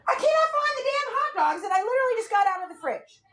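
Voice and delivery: high, fed-up wail; losing it over lost food; real frustration; exasperated. Real, not acted. Meltdown over hot dogs.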